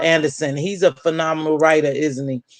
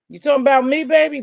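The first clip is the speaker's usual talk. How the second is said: teasing challenge; 7 semitones higher, faster than usual. Real, not acted. Teasing back at a commenter.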